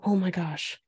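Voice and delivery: ordinary delivery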